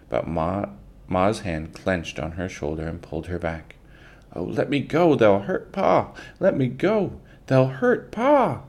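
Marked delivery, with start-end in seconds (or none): 4.3-8.6 panicked cry, climbing much higher; girl desperate to run to her father; screamed in a whisper. Acted. Girl begs her mother to let her go, rising panic. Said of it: "Laura screamed in a whisper".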